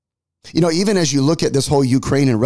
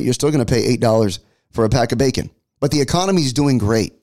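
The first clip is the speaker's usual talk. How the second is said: ordinary talk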